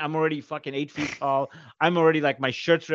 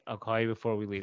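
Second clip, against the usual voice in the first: softer, low, understated phone reply; low-key son; 3 semitones lower, 9 dB quieter than usual. Retold or imitated. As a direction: say it quietly and matter-of-fact. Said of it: "that's me. I'm just like".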